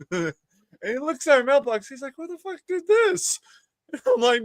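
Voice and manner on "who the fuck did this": high-pitched mock indignation; put-on high, incredulous voice; retold indignant shock. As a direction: imitate a housemate's outraged discovery, pitched way up. Housemate's reaction to broken mailbox, acted.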